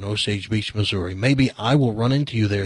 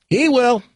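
high, louder repeated warning; insistent father; 10 semitones higher, 4 dB louder than usual. Acted. Father warning his daughter, the repeat higher and louder. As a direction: push the repeat up hard.

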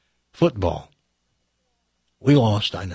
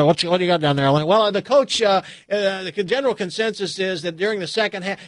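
lightly voiced sideline reporter, higher pitch; higher, halting TV-reporter patter; mock sideline reporter; 6 semitones higher, faster than usual. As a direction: imitate a hesitant on-field report.

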